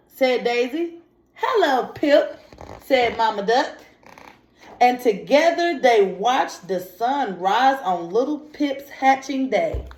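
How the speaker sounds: lively read-aloud, pitch lifting on the story lines; storybook reading. Acted. Teacher reading a picture book aloud to young students. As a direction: read the story to the children with a little life.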